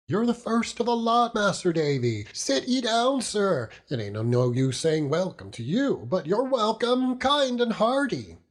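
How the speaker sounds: put-on high, beaming welcome from a rough old seaman; hearty old fisherman. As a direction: greet him like a big-hearted old sailor. Mr Peggotty welcomes Davy.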